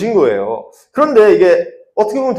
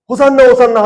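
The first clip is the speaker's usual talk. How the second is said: high, loud mock acclamation; crowd cheer; 8 semitones higher, 7 dB louder than usual. Fickle crowd hails Jesus, loud mock cheer. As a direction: chant it loudly like a cheering crowd.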